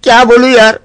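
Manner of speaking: ordinary delivery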